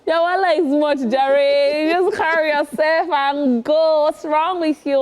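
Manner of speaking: high, drawn-out boss impression; imitated music boss. Host imitating [name] advising someone, boss-like mock scolding. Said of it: "he spoke like a true boss".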